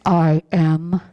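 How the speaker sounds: a hint of a deep booming voice; lightly voiced grand baritone; James Earl Jones. Minister doing a James Earl Jones voice for the congregation. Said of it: "insert, um, James Earl Jones' voice".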